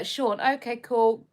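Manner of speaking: usual speaking voice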